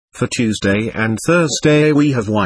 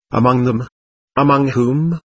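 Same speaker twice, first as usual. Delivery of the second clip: timid question. A narrator's reading. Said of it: "asked the monk, timidly".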